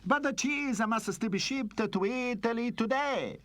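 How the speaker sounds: put-on high-pitched Italian-accented gang boss; theatrical Italian mobster. Gang boss reporting from Italy.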